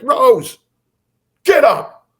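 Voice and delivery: loud, high imitated bellow; angry dad. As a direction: holler upstairs like a furious husband. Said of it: "And he's angry".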